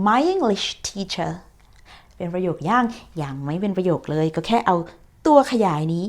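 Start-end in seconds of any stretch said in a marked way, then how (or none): 0.1-1.3 very slow, each word clear; slow model reading. Shown as a demonstration. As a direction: read my English teacher slowly and clearly. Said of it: "พอจะอ่านออกเสียงก็จะเป็น". (อ่าน my English teacher ช้าๆ ชัดๆ)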